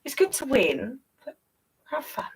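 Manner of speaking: ordinary delivery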